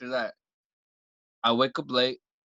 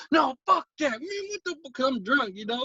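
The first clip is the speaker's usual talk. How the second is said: put-on high-pitched drunk protest; whiny, worked-up drunken outburst; drunken ranting; 9 semitones higher than usual. Refusing to calm down when drunk, ranting. Acts out his drunk angry self.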